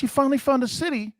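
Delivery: imitating a teasing wife, quick and high. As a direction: rattle it off as his wife needling him. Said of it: "She goes like this".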